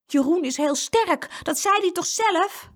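put-on high, emphatic girl's voice; pointed retort. A narrator's reading. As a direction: insist on it, rubbing it in. Sister retorts to the doubter.

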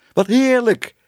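high, loud delighted cry; elated, soaring exclamation; overjoyed relief. A narrator's reading. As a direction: burst out with joy.

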